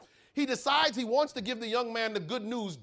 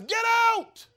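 high furious shout of get out; angry prisoner yelling at a preacher; 7 dB louder than usual. Acted. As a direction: throw the visitor out with a scream. Prisoner yells at visiting preacher.

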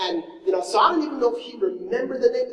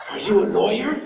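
puzzled driver; 5 dB louder than usual. Retold or imitated. Confused driver quoted.

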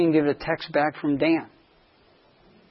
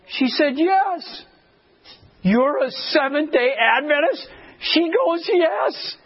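high, breathless disbelief building; rising excitement; 12 semitones higher, slower than usual. Retold or imitated. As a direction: let the wonder climb with each question. Incredulous recognition exchange.

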